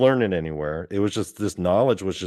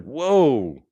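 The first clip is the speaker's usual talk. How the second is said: drawn-out, higher-pitched mock amazement; amazed; 4 semitones higher than usual. Dreamer reacting to the guy's new body, amazed. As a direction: let it swoop up, then fall.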